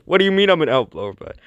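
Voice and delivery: acted indignant challenge, rushed; indignant protest. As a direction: fire back in mock outrage. Acted indignant reply in a quarrel.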